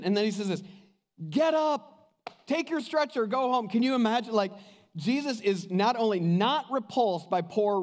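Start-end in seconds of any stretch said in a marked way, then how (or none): 1.3-3.7 joyful command. Retold or imitated. Jesus commands the healed man.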